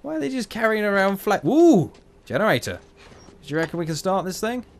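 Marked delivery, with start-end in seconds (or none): 1.5-1.9 sudden high, loud "ooh"; loud high whoop; startled whoop. Real, not acted. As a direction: yelp it out mid-sentence. Startled yelp during game action.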